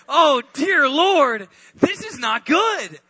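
high, slow, fretful wail; freaking out. Retold or imitated. Peter freaking out over following Jesus, high fretful panic. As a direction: wring your hands over it. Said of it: "freaking out".

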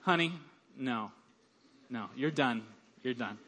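wife hushing her excited husband, low and soft; wife's low signal. Retold or imitated. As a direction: quietly cut him off. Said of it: "It's like".

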